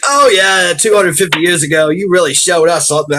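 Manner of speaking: high, loud dry sarcasm; sarcastic Brit. Retold or imitated. Brit mocking the revolution, high sarcastic. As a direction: needle him back. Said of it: "he's like".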